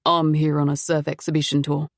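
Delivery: put-on refined foreign accent, not quite British. A narrator's reading. Stranger at the door introducing himself, refined foreign accent. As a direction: introduce yourself with a vaguely European accent. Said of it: "He had an accent".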